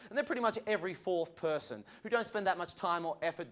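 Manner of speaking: ordinary talk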